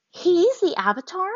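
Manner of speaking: gasping disbelief at the news; shocked. A narrator's reading. Azula to nobody in particular, high and shocked. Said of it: "just as shocked as the waterbender".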